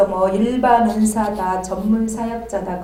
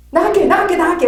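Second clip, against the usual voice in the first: much higher, louder frantic promise; panicked spirit; 8 semitones higher, 7 dB louder, faster than usual. Retold or imitated. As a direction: squeal like a fleeing demon. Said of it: "막 그러거든요".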